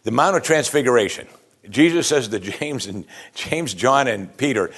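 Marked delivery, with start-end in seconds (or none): none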